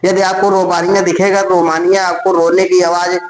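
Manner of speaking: ordinary delivery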